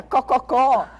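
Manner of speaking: loud high hen cluck, cococó. Shown as a demonstration. Therapist clucking like a hen for a child, loud and high. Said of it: "sons onomatopeicos".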